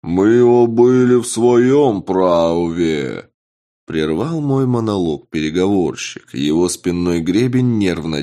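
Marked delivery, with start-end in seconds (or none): 0.0-3.3 slow, louder, stretched creature protest; indignant murloc voice. Acted. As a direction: drag it out, offended fish-man.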